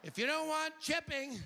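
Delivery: high, pushy "but wait" sell; infomercial pitchman. Pretend. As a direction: hype the offer like a late-night ad. Infomercial upsell parody.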